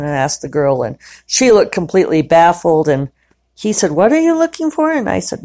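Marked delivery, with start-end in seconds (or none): 3.9-4.9 manager's question. Retold or imitated. Manager asks her, voiced higher.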